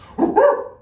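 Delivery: high, loud monkey whoop; monkey noise. Shown as a demonstration. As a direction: whoop like a monkey, rising sharply. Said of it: "make some monkey noises".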